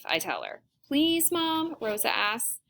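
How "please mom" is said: put-on high, wheedling child's plea; kid's hopeful, high-pitched begging; pleading. A narrator's reading. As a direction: beg your mother for permission. Daughter begging her mother for permission.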